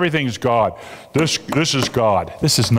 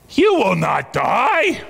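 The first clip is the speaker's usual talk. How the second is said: slow, sly serpent tempting; lightly voiced serpent, drawn out; serpent's voice; 6 semitones higher, slower than usual. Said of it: "the serpent said".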